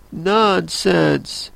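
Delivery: lightly voiced defiance, no real volume; mildly decided. A narrator's reading. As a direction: say it decidedly, a bit high. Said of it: "very loudly and decidedly".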